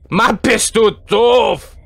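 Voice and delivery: slow, drawn-out shout; shouted insult. Real, not acted. Player berating Granny, slow exaggerated shout. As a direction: shout it word by word. Repeats insult loudly at game character.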